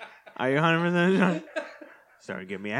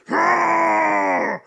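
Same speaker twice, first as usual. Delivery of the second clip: high raw scream of rage; hammed-up monster scream; Hulk roar; 11 semitones higher, 8 dB louder than usual. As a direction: let out a furious roaring yell. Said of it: "I'm gonna do it as the Hulk".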